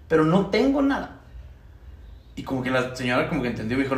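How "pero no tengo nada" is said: near tears. Retold or imitated. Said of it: "se lo dije casi llorando".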